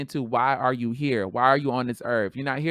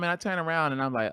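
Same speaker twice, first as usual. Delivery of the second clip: ordinary talk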